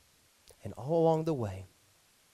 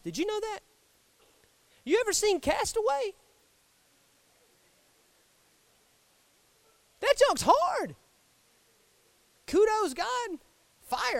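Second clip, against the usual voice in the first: much higher, light comic aside; joking aside; 5 dB louder than usual. Real, not acted. Preacher joking to the congregation about making fire, pitched much higher.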